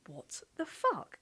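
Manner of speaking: high, slow and stunned; stunned disbelief. Retold or imitated. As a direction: drag it out in disbelief. Sees girlfriend's car on driveway.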